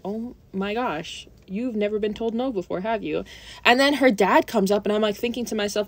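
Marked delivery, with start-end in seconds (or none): 0.0-3.2 quiet. Real, not acted. Exasperated aside.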